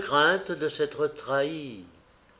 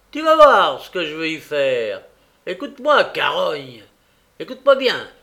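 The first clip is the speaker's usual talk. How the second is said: loud, furious threat; enraged, commanding outburst; exasperated shouting; 8 dB louder than usual. A narrator's reading. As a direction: shout it in sharp bursts. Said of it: "S'écria le juif exaspéré".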